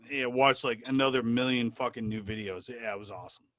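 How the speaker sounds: normal talking voice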